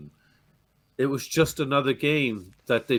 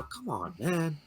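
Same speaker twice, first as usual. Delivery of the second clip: ordinary delivery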